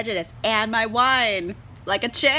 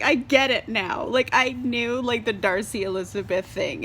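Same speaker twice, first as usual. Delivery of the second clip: ordinary delivery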